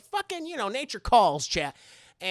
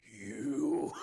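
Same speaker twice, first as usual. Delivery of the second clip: lower, soft menacing whisper-like call; creepy low "you"; 8 semitones lower, 6 dB quieter than usual. Retold or imitated. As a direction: creep the kid out. Esther menacing Max.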